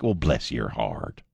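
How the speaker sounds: low, slow Southern bless-your-heart drawl; slow Southern accent. Retold or imitated. Host demonstrating a Southern dressing-down, slow Southern drawl. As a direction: drawl it out Southern, every syllable. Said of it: "slow, gonna pronounce every freaking syllable Southern accent".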